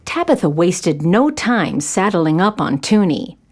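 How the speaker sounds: usual speaking voice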